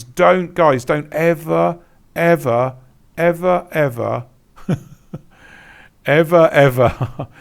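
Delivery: "don't ever, ever, ever" with each "ever" hit separately and slowly; emphatic warning. Real, not acted. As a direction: stretch out every "ever" as a stern warning. Warns viewers against grey backgrounds.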